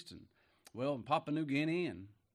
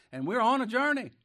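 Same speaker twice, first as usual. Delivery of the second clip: higher and louder, rousing the church; excited preaching; 10 semitones higher, 10 dB louder than usual. Real, not acted. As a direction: throw it out joyfully. Preacher's rising exclamation.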